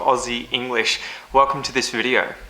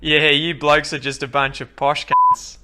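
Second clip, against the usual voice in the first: broad Australian accent, mocking; broad Aussie bloke taking a dig; broad Aussie heckle; 2 semitones higher than usual. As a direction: jeer at them in broad Strine. Broad Pete mocks the posh speakers.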